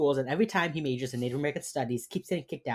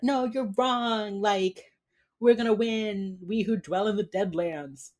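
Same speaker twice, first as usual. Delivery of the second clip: higher, drawn-out retold protest; lightly acted tearful vow; mock tearful defiance; 7 semitones higher, slower than usual. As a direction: imitate a slightly weepy, defiant insistence. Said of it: "like, crying or whatever".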